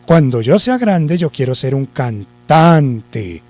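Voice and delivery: "cantante" with a long, punched middle syllable, can-TAAAN-te; slow sentence, stretch TAN in "cantante"; stress on TAN. Shown as a demonstration. Teacher moves the accent along, long stress on TAN in "cantante". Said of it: "Entonces la corremos".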